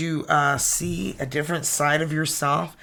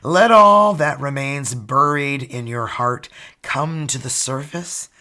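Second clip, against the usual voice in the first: reading a card message; 6 dB louder than usual. Acted.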